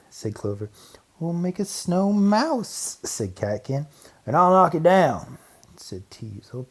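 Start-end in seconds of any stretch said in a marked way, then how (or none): 1.2-3.0 put-on higher, slow little mouse girl. Little girl voiced in story. 4.2-5.4 put-on higher, louder teasing boy; a little brother's boast; cheeky boy. Cheeky boy voiced in story.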